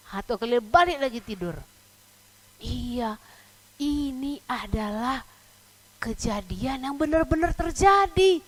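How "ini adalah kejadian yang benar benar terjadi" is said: ordinary delivery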